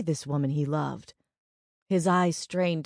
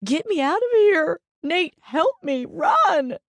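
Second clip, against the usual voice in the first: high, loud, hysterical cries; desperate high-pitched shout; sobbing plea; 13 semitones higher, 7 dB louder than usual. A narrator's reading. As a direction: scream for help in tearful panic. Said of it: "she sobbed".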